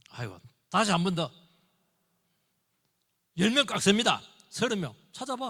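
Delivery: Abraham bargaining down the number; haggling Abraham. Retold or imitated. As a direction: haggle with God like a merchant.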